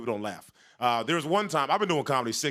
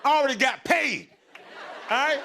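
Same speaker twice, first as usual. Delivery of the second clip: raised, high-pitched challenge; defiant comeback; 8 semitones higher, 4 dB louder than usual. Retold or imitated. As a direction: throw it loudly back at the heckler. Acted comeback to the heckler.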